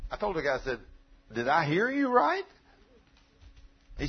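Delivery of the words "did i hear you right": incredulous question. Retold or imitated. Preacher retells asking supplier.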